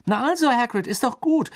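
high, loud, upbeat encouragement; cheerful relief. A narrator's reading. Ron slaps Hagrid's shoulder, delighted and encouraging. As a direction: cheer him up, pitch way up.

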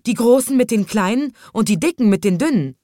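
high, loud despairing complaint. A narrator's reading. Conductor lists who is quarrelling, loud and despairing. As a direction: pile on the grievances in despair. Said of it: "sagte der Schaffner verzweifelt".

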